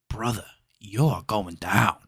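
a hint of a mobster threat; lightly voiced Pacino. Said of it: "great lines like".

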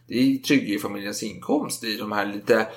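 usual speaking voice

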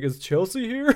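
timid squeaky voice; nervous high question. Retold or imitated. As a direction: ask it small and scared. Nervous intruder asking.

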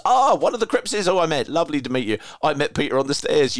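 high and loud, gushing over the message; bubbly, delighted reading of a fan text; excited read-out. Retold or imitated. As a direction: read it like you're thrilled to bits.

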